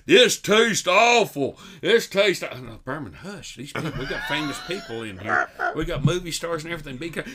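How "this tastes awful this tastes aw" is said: put-on loud, slow drunken complaint; hammed-up loud griping; louder and louder. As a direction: bellow it like a tipsy grump. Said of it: "He'd get louder and louder".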